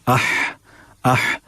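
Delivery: आह with हमज़ा leading into the ह; हा with hamza before it. Shown as a demonstration. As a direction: say आह, a hamza then a breathy ह. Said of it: "उससे पहले हमज़ा लगा लें". (हमज़ा लगाकर आह का अभ्यास)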